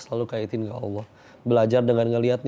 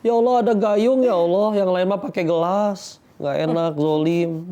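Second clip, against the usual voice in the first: high, bright, marvelling "ya Allah"; excited gratitude; 8 semitones higher, 4 dB louder, slower than usual. Acted. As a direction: gush with delight over small blessings. Grateful worshipper in the bathroom.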